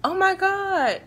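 high-pitched imitation of a shocked friend; lightly hammed-up friend gasping the news; imitating her friend. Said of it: "So she's like".

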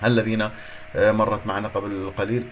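ordinary talk

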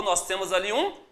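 ordinary talk

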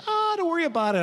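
high, airy brush-off; breezy nurse. Retold or imitated. Second nurse to anxious patient about the vein, breezy. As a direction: imitate a confident nurse waving worry away.

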